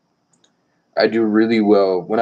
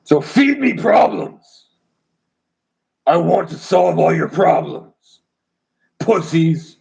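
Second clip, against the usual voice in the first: much higher, slow, drawn-out mocking voice; put-on taunting voice; 8 semitones higher, slower than usual. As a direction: stretch it out in a high put-on voice. Sudden put-on high taunting voice.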